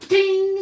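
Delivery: high, loud mock "Ding!" chime; imitated quiz ding. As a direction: ring it out like a game-show chime. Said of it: "and it goes".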